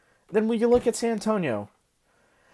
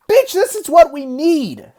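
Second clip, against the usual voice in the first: high, loud and slower, insisting; pitched-up, slowed, heated; hysterical high pitch; 7 semitones higher, 6 dB louder, slower than usual. Real, not acted. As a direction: push the pitch up in real exasperation. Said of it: "I'm sorry for the high pitch".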